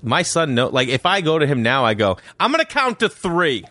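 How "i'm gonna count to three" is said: slow, higher, firm count warning; stern warning. Shown as a demonstration. Dad warning his son, slow stern countdown. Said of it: "And that's how I say it".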